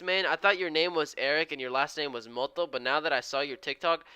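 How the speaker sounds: ordinary delivery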